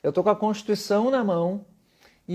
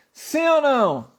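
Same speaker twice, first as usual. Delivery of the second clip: higher, louder, slow drawn-out question; emphatic call-out; 7 semitones higher, 5 dB louder, slower than usual. Shown as a demonstration. Teacher rallies his online students for an answer. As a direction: demand an answer from the class. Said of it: "a vida toda eu perguntei isso".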